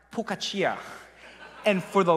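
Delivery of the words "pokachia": "focaccia" as po-ka-chee-ah; Korean-accented "focaccia", chee-ah at the end; Korean-style pronunciation. Shown as a demonstration. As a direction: say "focaccia" with a p for the f and four syllables. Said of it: "in Korean, this is pronounced focaccia".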